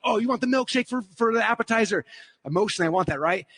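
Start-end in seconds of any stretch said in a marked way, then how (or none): 0.0-2.0 higher-pitched, eager inner voice; voice of emotion. Acted. Emotion tempting him at the drive-thru, eager high voice. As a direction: play the tempting emotional side. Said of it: "my emotion's like".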